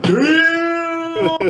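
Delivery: high, loud, stunned exclamation; amazed shout. Retold or imitated. Reacting to the sergeant major's threat, a high amazed shout. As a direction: yelp it out in disbelief.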